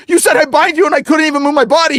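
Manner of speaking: high-pitched, breathless-sounding retold panic; panicking. Man tells his friend he was frozen, panicking. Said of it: "starts panicking".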